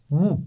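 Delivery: clean short مو with pesh; short mu sound. Shown as a demonstration. Teacher models the pesh syllable for children, short and calm. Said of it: "آرام سے پڑھیں گے".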